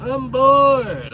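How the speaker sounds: put-on high, loud whining kid voice; whiny daughter. Dad imitating his bored daughter, loud whiny kid voice. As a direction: mimic a bored child whining. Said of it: "until she started complaining".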